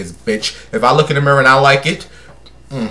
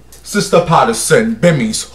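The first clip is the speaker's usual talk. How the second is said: usual speaking voice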